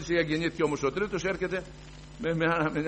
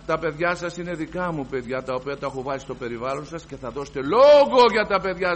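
firm, louder pronouncement; solemn divine voice; 2 semitones higher, 10 dB louder than usual. Retold or imitated. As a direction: deliver it as a stern command. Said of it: "στεντόρια φωνή". («τα παιδιά σας» σε πιο ροή, επίσημη φωνή)

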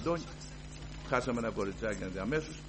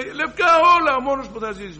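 much higher and louder; exultant announcement; 12 semitones higher, 13 dB louder than usual. Retold or imitated. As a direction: announce the good news in triumph. Doctor announces the miracle. (ενθουσιασμένη αναγγελία, πολύ ψηλά)

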